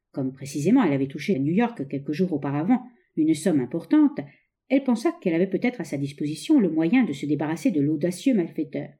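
ordinary delivery

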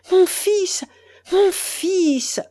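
mother's mounting cry for her son; growing energy. Acted. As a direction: let it swell to a cry. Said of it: "répéta-t-elle avec une énergie croissante".

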